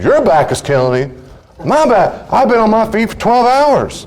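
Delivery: high, incredulous retort; hammed-up one-upping outrage; acted indignation. Acted spouse outdoing the complaint.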